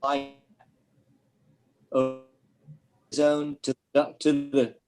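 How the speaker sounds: choppy broken-up call audio; call dropping out. Real, not acted. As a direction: voice cutting in and out. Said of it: "You're breaking up".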